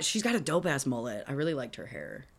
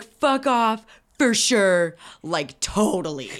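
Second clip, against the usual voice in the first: loud, stretched parting shots; raised, hammed-up kiss-off; yelling; 3 semitones higher, 7 dB louder, much slower than usual. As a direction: shout it as a mock tantrum exit. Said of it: "he's just standing there going".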